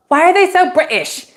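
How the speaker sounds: high, mimicked seven-year-old; imitated kid. Daughter turning to mom in Times Square, imitated high kid voice. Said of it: "Alyssa turns to me and she goes".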